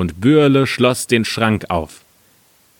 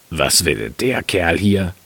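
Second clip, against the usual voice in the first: slow, put-on miller's voice; misstrauischer Müller; 3 semitones lower, slower than usual. A narrator's reading. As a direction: question the stranger in a slow character voice. Miller questions stranger, low slow voice. (misstrauischer Müller, langsam und verstellt)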